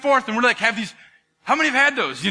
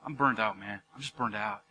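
low, soft, drained mock complaint; burned-out weariness; 9 semitones lower, 7 dB quieter than usual. Churchgoer admitting exhaustion to a friend, acted weariness. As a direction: let it sag, worn out. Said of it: "where we feel like".

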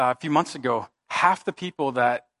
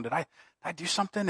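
anxious quiet plea; 2 semitones higher, 9 dB quieter, faster than usual. Retold or imitated. Retold anxious prayer.

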